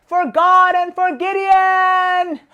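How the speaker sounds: high, drawn-out battle cry; rallying shout. A narrator's reading. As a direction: shout it out long and high like an army charging. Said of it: "his men would break the jars and shout".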